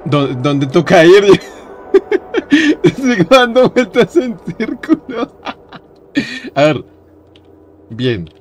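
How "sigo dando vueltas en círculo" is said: high-pitched, helpless laughing speech. Real, not acted. Laughing at going in circles. (hablando entre risas, agudo)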